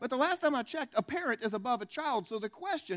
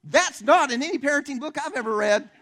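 high, shouted punchline; loud incredulous exclamation; 7 semitones higher, 10 dB louder than usual. Real, not acted. Preacher commenting on the dad's reply, loud and incredulous. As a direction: shout it out in mock outrage. Loud aside after the retold yell.

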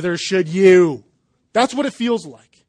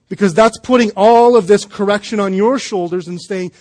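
ordinary talk

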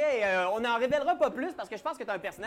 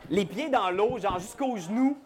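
ordinary talk

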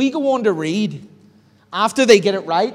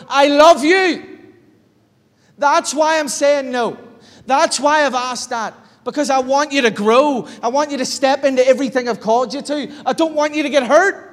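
a fervent loving father's voice, higher; loving God; 5 semitones higher, 4 dB louder than usual. Acted. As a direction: plead with love like a father.

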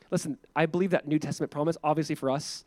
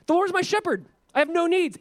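triumphant; 12 semitones higher, 4 dB louder than usual. Acted. Triumphant quote of the psalm.

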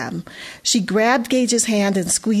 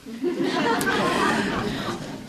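high laugh; 10 semitones higher, 5 dB quieter than usual. A narrator's reading. Laugh in the story.

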